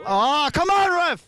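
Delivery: high, loud protest at the ref; yelling from the sidelines. Real, not acted. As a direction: shout it across the field. Yelling at the referee.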